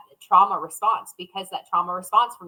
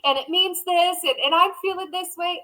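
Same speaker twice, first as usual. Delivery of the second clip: high whiny list of complaints; mock victim whine; 10 semitones higher than usual. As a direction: whine through every accusation. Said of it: "full of this victim mentality".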